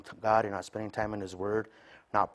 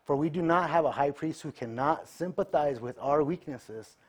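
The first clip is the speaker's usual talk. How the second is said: scripture reading; 5 semitones higher than usual. Acted.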